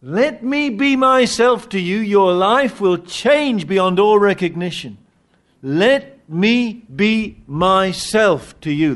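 voicing Jesus. Preacher voicing Jesus to the congregation, fervent. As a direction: plead it as Jesus, slowing at the end.

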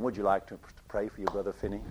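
low mock American voice; American accent. Prayer meeting leader asking Finney, in an American accent. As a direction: put on a rough American accent, pitched well down. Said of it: "Sorry, that was an American accent".

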